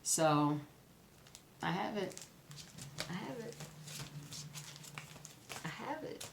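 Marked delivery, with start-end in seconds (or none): 1.6-6.2 hushed, then rising higher with each repeat; building giddy excitement. Real, not acted. Vlogger savoring her new pattern, hushed then rising excitement. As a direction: whisper it to yourself, growing more thrilled. Finally owns the coveted pattern.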